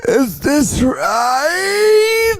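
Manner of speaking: high, loud, dragged-out crying question; mock sobbing plea. Patient wailing to the doctor whether she did it right. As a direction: wail the question very slowly.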